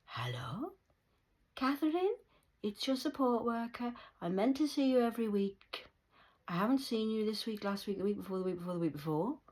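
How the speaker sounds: high, sing-song concerned voice; mock caring worker. Pretending to be the support worker checking in. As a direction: sweetly fake the worker's concern. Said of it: "He failed".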